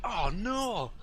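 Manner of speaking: high, loud imitated group cry of surprise; crowd exclaiming. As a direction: bystanders wishing they had grabbed it first, exclaiming. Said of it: "like that around".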